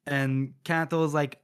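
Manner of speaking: plain reading voice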